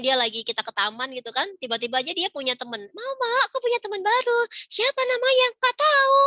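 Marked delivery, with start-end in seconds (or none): 2.9-4.4 put-on much higher, bright little kid announcing news; excited child voice. Child telling mama about a new friend, high excited voice. Child tells mother at park. 4.7-5.5 higher, louder mock question; high amused mother. Mother asking the child the friend's name, high and loud. 5.6-6.2 put-on very high, loud kid reply; carefree child. Child admitting not knowing the friend's name, high and loud.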